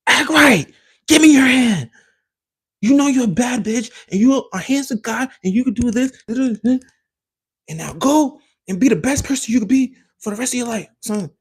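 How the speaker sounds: high, loud coach yell; mock motivational yell. Instagram guy yelling advice at viewers, loud and high. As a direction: bark it out like a hype man. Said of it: "when it yell".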